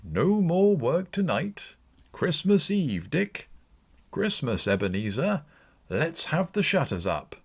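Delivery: put-on a jolly old master shouting orders to close up; Fezziwig crying out. A narrator's reading. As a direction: hail the lads with festive cheer. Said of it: "cried old Fezziwig".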